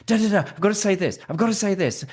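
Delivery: fast, high, hurried gabble; mock a panicked candidate cramming it all in; rushed info dump. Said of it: "people speak fast".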